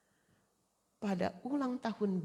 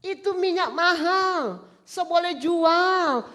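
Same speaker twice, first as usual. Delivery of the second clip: much higher, louder, slow indignant complaint; Judas protesting; 11 semitones higher, 9 dB louder, much slower than usual. Acted. Judas scolding the woman over costly oil, indignant. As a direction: drag it out, outraged over the waste. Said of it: "Yudas bilang".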